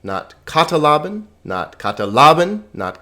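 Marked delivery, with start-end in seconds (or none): 0.4-1.2 katalaben as KAT-a-la-ben, high and rising; wrong first-syllable stress. Shown as a demonstration. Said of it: "hit that syllable that has the accent". 1.8-2.6 katalaben as kat-a-LA-ben, loud LA; wrong third-syllable stress. Shown as a demonstration. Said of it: "hit that syllable that has the accent".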